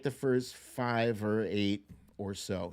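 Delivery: ordinary talk, nothing marked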